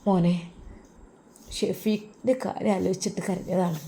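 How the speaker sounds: put-on quiet, choked voice of an old teacher after crying; tearful. Old teacher tells his student why he wept, quietly tearful. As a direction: speak softly as if tears just stopped. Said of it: "ഉസ്താദ് കരയുകയാണ്".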